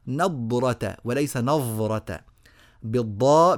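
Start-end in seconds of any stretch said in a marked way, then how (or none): 2.8-3.6 بالضاء with a clear emphatic th sound; ẓāʾ sound. Shown as a demonstration. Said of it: "بالضاد أخت الصاد".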